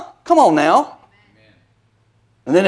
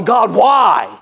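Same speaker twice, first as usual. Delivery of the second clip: mock cry out to God; anguished cry. Preacher acting someone weeping in the closet, crying out. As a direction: call out to God in pain.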